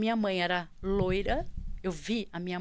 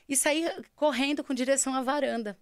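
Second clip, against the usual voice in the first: ordinary talk, nothing marked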